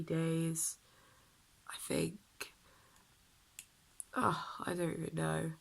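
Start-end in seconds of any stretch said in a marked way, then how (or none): none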